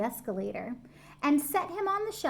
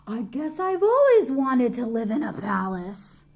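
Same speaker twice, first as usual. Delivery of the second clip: lightly voiced small bear, a bit louder; a hint of a wondering toy bear; lightly voiced bear, delighted; teddy bear voice; 6 dB louder than usual. A narrator's reading.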